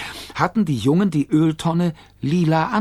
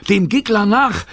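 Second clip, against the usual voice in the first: high, loud command; urgent chase order; 8 semitones higher, 5 dB louder than usual. A narrator's reading. Jimmy urging the mother on, high loud call. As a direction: sound the charge.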